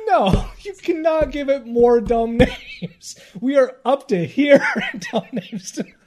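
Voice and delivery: protesting through laughter; words breaking up with giggles; laughing. Real, not acted. Laughing while protesting silly names.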